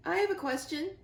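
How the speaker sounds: a goose calls out over the flock, booming. A narrator's reading. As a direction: boom it over the crowd. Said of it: "came a booming voice".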